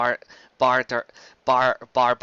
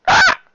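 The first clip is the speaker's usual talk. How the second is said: sudden high yelp of surprise; a sharp, high cry as something hits him; loud startled shriek; startled yell; 11 dB louder than usual. Acted. Cut off by the mail bird.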